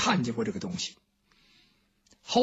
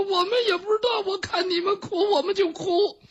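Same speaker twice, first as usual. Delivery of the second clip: very high blubbering, first words cried out; comic wailing; 11 semitones higher than usual. Acted. Maids outside wail that they cry because the others cry. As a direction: sob it out high, bursting out at the start. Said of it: "他们在那抽抽搭搭的哭".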